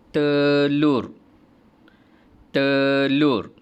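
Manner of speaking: "telur" loud and high, falling; modelled word, said twice. Shown as a demonstration. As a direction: sebut "telur" dengan kuat. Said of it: "semua boleh sebut".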